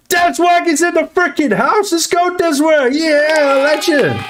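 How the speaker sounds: very high-pitched excited holler; hyped shout-out. Real, not acted. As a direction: hype up the house in a high sustained cry.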